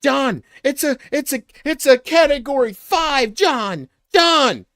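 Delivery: high, loud frantic shouting over a storm; screaming action hero in a storm; panicked movie yell. Acted. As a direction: yell a warning into the wind. Movie scene of tornado panic.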